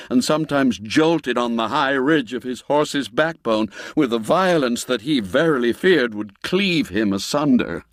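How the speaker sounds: plain reading voice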